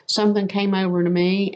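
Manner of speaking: ordinary talk, nothing marked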